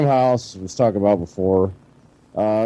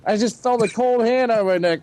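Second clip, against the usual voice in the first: usual speaking voice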